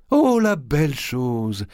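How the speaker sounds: high, slow, put-on delight; exclaiming. A narrator's reading. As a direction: gush loudly over the gift so the widow hears. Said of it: "s'écria-t-elle".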